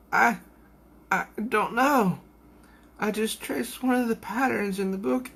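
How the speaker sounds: high, small frightened voice; scared girl. Acted. Coco answering Queefrey, high and frightened. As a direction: near tears, stammering out an excuse. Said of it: "she's very scared".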